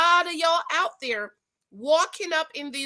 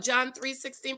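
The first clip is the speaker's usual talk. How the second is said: ordinary talk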